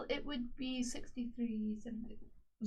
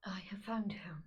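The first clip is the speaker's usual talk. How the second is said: whisper; 3 semitones lower, 5 dB quieter than usual. Acted. Aunt reveals news to nephew.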